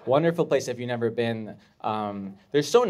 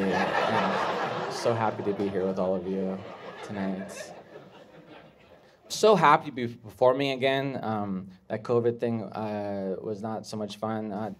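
ordinary talk, nothing marked

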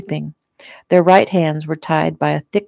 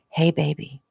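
soft; 5 dB quieter than usual. A narrator's reading. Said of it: "Bree said softly".

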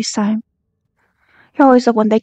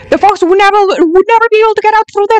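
indignant disbelief; 8 semitones higher than usual. Real, not acted. Fan arguing with the video about the fox, indignant. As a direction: protest it can't be possible.

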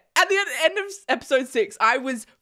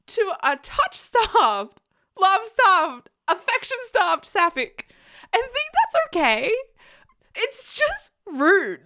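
mock outrage building; 7 semitones higher than usual. Repeating list, building outrage.

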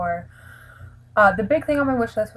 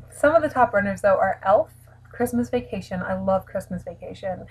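usual speaking voice

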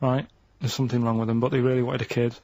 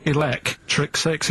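slow repeat; slower than usual. Shown as a demonstration. Repeats answer slowly to Steve.

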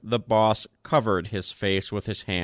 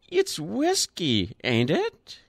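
put-on high, thin old man's pipe; high feeble old man's voice; piped feebly; 9 semitones higher than usual. A narrator's reading. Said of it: "he piped feebly".

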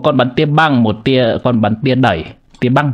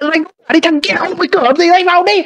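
high-pitched taunt; faster than usual. Acted. Player taunting the monster in a much higher voice. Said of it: "anh chửi nốt cao quá".

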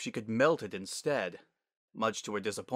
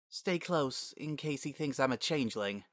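lightly voiced young mare, higher; a hushed, uneasy aside; nervous Sunset; 4 semitones higher, 4 dB quieter than usual. Sunset to Twilight at her father's door, nervous.

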